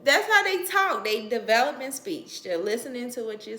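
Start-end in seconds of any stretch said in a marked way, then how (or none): 0.0-1.0 loud, high insistence; emphatic correction. Real, not acted. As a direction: insist firmly it is normal. Emphatic rebuttal.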